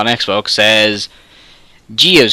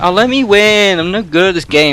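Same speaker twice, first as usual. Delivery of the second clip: higher, pleading fake Brummie voice; mock Birmingham accent; 9 semitones higher than usual. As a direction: imitate a Birmingham woman, pitched up. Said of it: "I'm trying to do Birmingham accent".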